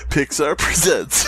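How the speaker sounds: slow, drawn-out trailer voice, a touch of grandeur; movie trailer announcer. Pretend.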